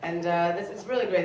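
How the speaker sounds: ordinary delivery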